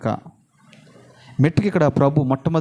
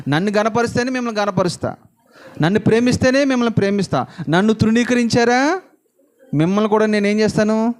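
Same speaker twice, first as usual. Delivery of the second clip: emphatic preaching; 6 semitones higher than usual. Acted. Voicing God's terms.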